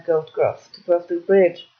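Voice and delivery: ordinary delivery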